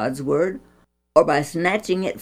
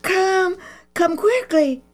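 hoarse whisper; 12 semitones higher than usual. A narrator's reading. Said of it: "she called in a hoarse whisper".

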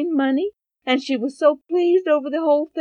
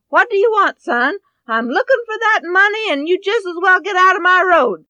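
higher, louder impatient scolding; irritated mother; 7 semitones higher, 5 dB louder, faster than usual. Acted. Mother snapping at her son while searching, loud and irritated. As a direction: brush the boy off, annoyed.

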